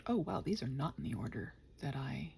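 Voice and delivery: ordinary delivery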